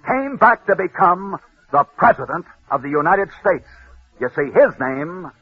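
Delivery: hushed, solemn tribute over music; old-time broadcaster's memorial close; dramatic radio storytelling. A narrator's reading.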